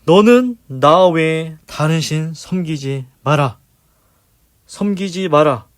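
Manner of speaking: loud, slow commanding decree; voice of God. Retold or imitated. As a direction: proclaim it as God's command. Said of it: "우리에게 말씀하십니다".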